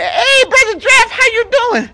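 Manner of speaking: high, loud friendly greeting; friendly giraffe. Acted. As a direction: call up cheerfully as a tall giraffe. Storyteller voices the giraffe.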